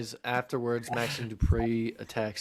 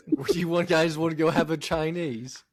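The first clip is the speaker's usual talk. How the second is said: higher-pitched, put-on British invitation; mock British accent; 6 semitones higher than usual. Host inviting mates for takeaway, mock British accent. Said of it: "lost all of our British listeners".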